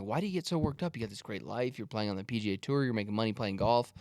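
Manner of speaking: softly reasoning with him; retold pep talk. Speaker reasoning with a worked-up tour golfer, soft and sensible. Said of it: "I'm like".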